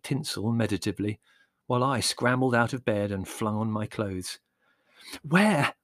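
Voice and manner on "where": high, eager, breathless question; breathless inquiry. A narrator's reading. Boy asking Mother about his donkey, breathless. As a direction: blurt it out breathlessly. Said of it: "I inquired breathlessly of Mother".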